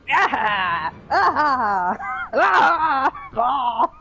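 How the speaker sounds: rising scream. Acted. Screams at monster.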